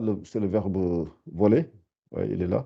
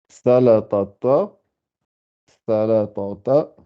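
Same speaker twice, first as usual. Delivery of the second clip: slow repetition; slower than usual. Shown as a demonstration. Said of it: "faut bien, bien articuler".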